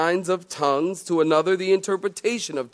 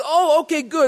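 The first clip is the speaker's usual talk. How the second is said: higher, pleased woman; lady caller voice; 10 semitones higher, 4 dB louder than usual. Retold or imitated. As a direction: play the satisfied lady caller. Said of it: "And she said".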